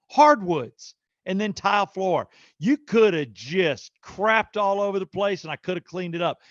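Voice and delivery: venting in disbelief at the mess; exasperated rant. Retold or imitated. Retold rant at mom over carpet.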